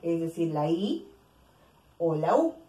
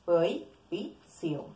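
prohibición spoken very slowly, each syllable apart; slow example word; much slower than usual. Shown as a demonstration. Teacher demonstrating hiatus to the class, very slow. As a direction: spell out prohibición by ear, slowly.

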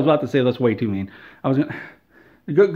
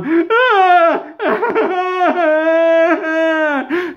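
high wailing fake sobs, hammed-up; mock sobbing; 11 semitones higher, much slower than usual. Imitating Todd Howard crying in his office, hammed-up high wails. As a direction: wail in mock sobs like a grown man crying. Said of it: "That's what he sounds like".

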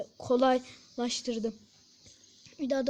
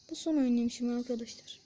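normal talking voice

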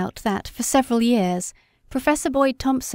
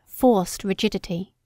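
ordinary delivery, nothing marked

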